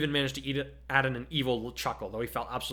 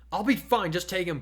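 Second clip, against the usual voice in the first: urgent; 6 semitones higher, 4 dB louder than usual. A narrator's reading. Urging partner in a fight.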